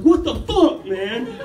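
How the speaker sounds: high, loud mock outburst of shock; retold indignant shout, pitch jumping up; outraged yelp. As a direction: yelp it like you just got scratched. Reacting to monkey scratching his leg.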